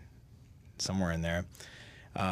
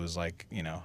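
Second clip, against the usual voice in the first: usual speaking voice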